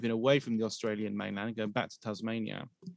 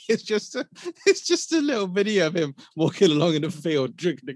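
laughing; 7 semitones higher, 8 dB louder than usual. Real, not acted.

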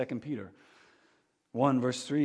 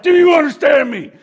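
barking drill sergeant shout; 11 semitones higher, 14 dB louder than usual. Pretend. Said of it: "more like a drill sergeant".

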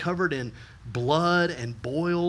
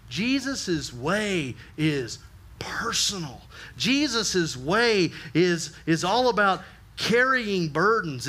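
slow emphasis; slower than usual. Acted.

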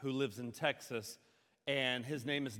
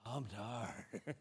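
low, soft sigh breaking into a laugh; acted weariness; 4 semitones lower, 6 dB quieter, much slower than usual. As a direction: groan it quietly, mock exhausted. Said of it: "we're just going".

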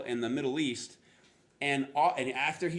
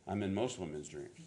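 smug joker; 7 semitones lower, 7 dB quieter than usual. Retold or imitated. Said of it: "some people will be like".

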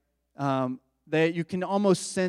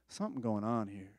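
uneasy murmur; 6 semitones lower, 10 dB quieter than usual. Retold or imitated. Nervous aside, uneasy listener.

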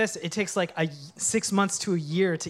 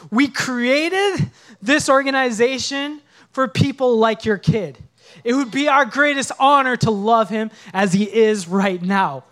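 louder, earnest reassurance from the director; a touch of a firm, kind promise, louder; firm retold promise; 3 semitones higher, 7 dB louder than usual.

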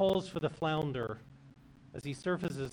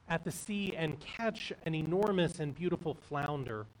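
usual speaking voice

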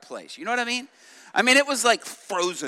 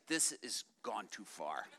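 low, very soft, slow disapproval; hushed aside; 10 semitones lower, 17 dB quieter than usual. Retold or imitated. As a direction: confide it grimly to the person beside you. Retold aside to person beside him.

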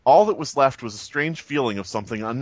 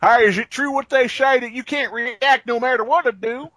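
loud Southern American tourist; Southern accent; 10 semitones higher, 4 dB louder than usual. Acted. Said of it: "I like how he's Southern".

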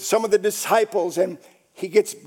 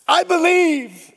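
high, loud proclamation; decreed; 10 semitones higher, 5 dB louder than usual. Retold or imitated. Father proclaiming faith to Jesus, loud decree. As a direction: declare it as a ringing decree. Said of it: "he decreed".

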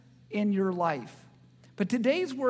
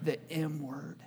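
softer, lower, as if it were a swear; hushed mock taboo; 6 semitones lower, 11 dB quieter than usual. Pastor joking about Michigan lowers his voice like a swear. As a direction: drop the voice like saying a dirty word. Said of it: "it's like a bad word".

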